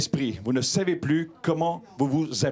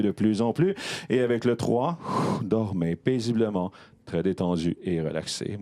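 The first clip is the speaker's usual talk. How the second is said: hypnotic induction; 7 semitones lower than usual. Acted. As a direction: ease the audience into sleep.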